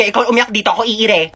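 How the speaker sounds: ordinary talk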